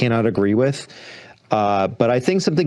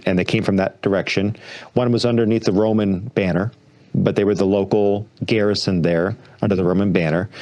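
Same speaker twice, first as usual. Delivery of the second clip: ordinary talk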